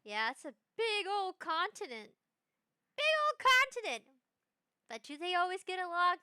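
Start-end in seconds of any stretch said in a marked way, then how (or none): 3.0-4.0 high, loud exclamation; emphatic repeat. Real, not acted. Streamer repeating for effect to viewers, loud emphatic repeat.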